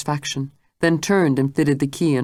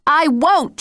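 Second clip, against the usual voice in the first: much higher, louder cry; defiant refusal; 12 semitones higher, 5 dB louder than usual. Acted. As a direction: refuse her outright. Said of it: "she said".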